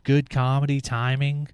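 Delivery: ordinary talk, nothing marked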